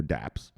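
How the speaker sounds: one-beat "daps" for dapps; dapps as one word. Shown as a demonstration. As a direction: say dapps in one syllable, rhyming with caps. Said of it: "some people just say dapps".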